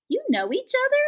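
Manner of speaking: high, bright little gosling voice; squeaky young bird asking; gosling's voice. A narrator's reading.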